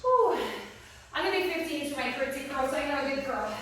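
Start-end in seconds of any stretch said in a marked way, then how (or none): 0.0-0.4 a sharp high whew of effort, blowing out hard; exerted whew. Real, not acted. Breathless coach's loud high whew to the class.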